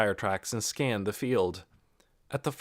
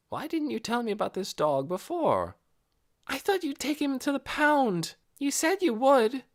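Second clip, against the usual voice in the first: put-on girl voice. Dialogue in car.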